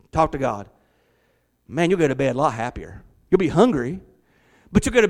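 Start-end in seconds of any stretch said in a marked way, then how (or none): none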